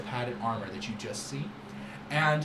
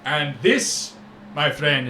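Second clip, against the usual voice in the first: showy merchant voice. Acted. Syrah introducing Ceres.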